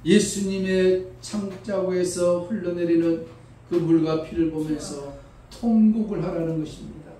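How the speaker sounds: usual speaking voice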